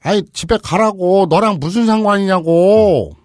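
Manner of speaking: slow loud high yelling; angry yell. Acted. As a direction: drag it out, furious. 아버지 역 외침. (화난 아버지의 느린 고함)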